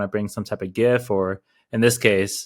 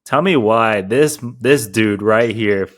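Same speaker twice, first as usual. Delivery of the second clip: slow, louder, exaggerated grievance; mock complaint; 2 semitones higher, 7 dB louder, slower than usual. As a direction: drag it out like a wronged friend. Retelling complaint about eaten snacks.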